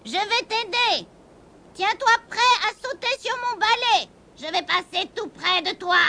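high, swooping witch voice. A narrator's reading. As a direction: instruct the kitten in a put-on witch voice.

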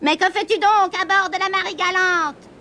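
high witch voice questioning the kitten. A narrator's reading. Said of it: "lui demanda la sorcière".

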